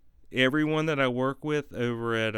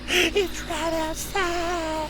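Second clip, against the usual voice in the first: very high, slow panicked wail; high, drawn-out fearful cry; terrified; 4 dB louder, much slower than usual. Acted. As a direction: stretch it out in hammed-up terror. Frightened in woods sketch.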